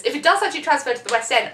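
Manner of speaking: ordinary delivery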